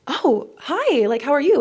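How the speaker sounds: high, mock-proper phone greeting; overly proper. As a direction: put on your most proper, high-pitched hello. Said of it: "really proper and be like".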